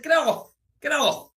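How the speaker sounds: high, loud panicked yell; panicked shout. Retold or imitated. Telling the stranger to get off, panicked shout. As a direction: shove him off with your voice.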